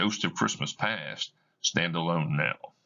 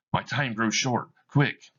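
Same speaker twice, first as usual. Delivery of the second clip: rising to a higher urgent cry; urgent ghost; 6 semitones higher than usual. Acted. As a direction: hurry him along, alarmed. Ghost urges haste.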